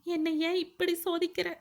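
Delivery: இப்படி high and strained, cry to God; anguished prayer. A narrator's reading. Wife cries out to God, anguished.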